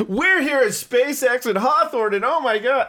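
high, slow-stretched, over-upbeat TV host; mock upbeat squeal for the camera; super happy. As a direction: gush like a hammed-up reality TV presenter. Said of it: "like, super happy".

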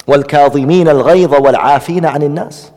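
quick flowing Arabic recitation; fast recitation of the verse; Quran recitation. Real, not acted. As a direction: recite the verse swiftly and evenly.

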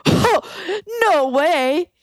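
high incredulous "oh no way"; mock surprise. Teller imitating [name]'s high disbelief. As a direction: squeak it out in disbelief.

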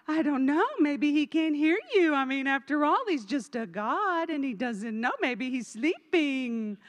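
lightly voiced sarcastic Elijah, drawn out at the end; sarcastic mock. Elijah mocking the prophets of Baal, dry sarcasm. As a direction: tease the false prophets with mock concern. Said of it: "Elijah is so sarcastic".

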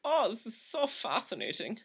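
very high, delighted German accent. Shown as a demonstration. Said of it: "all of my German characters".

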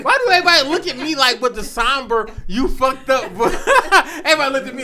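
high, loud laughing words. Real, not acted. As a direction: laugh and protest at once.

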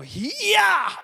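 high, loud victory shout; triumphant yell. Retold or imitated. Boy yelling as his card throw finally works. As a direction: whoop as the card finally flies. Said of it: "and I go".

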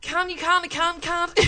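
put-on posh Dublin 4 accent, laughing; D4 accent with giggles. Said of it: "In your best D four accent".

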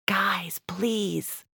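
hushed, urgent whispered plea; soft, breath-only shushing plea; stage whisper. A narrator's reading. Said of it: "Roberta stage whispers".

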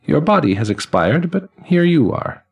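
put-on high, ringing elderly man's voice; chiming old man. Elderly man greeting the newly dead, high and chiming. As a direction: chime each word like silver cymbals and laughter.

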